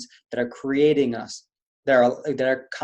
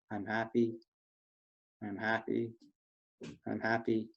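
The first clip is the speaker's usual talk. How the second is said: much softer, downcast mumble; gloomy; 3 semitones lower, 11 dB quieter than usual. Shown as a demonstration. Coach demos happy words in a miserable tone. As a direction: say it like you don't believe it. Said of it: "In this tone".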